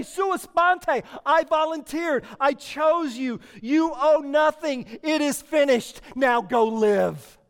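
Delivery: loud, ringing proclamation voicing Christ; Jesus proclaiming. Preacher voicing Jesus on the cross to the church, loud. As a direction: shout it as a triumphant declaration. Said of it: "you hear him say".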